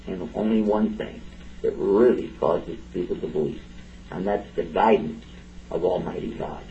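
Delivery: normal talking voice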